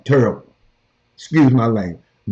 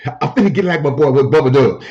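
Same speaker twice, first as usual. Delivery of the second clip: usual speaking voice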